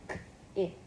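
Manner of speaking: crisp K alone, then Ick; separated sounds. Shown as a demonstration. Showing finger spelling of kick, sounds separated. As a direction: keep K and Ick clearly apart. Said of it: "Make sure we separate those sounds".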